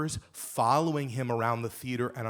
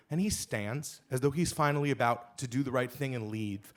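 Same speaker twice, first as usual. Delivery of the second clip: ordinary talk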